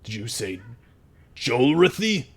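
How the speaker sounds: doubting goblin, rising on the name; second goblin voice. Acted. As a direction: halt everyone, then question the name. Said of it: "the second voice go".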